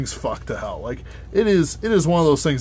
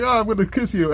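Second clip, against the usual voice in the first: raised, higher pitched mock swoon; swooning girlfriend; 9 semitones higher, 3 dB louder than usual. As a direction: coo it like a lovestruck girl. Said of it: "it's like".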